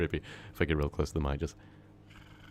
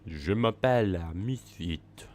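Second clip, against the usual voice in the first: put-on French accent; 3 dB louder, slower than usual. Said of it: "speaking French".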